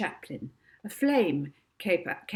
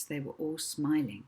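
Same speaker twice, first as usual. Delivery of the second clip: ordinary delivery, nothing marked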